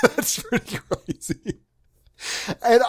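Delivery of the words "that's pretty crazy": breathy laugh through the words; laughing at his own joke. Real, not acted. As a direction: giggle the line out slowly, almost voiceless.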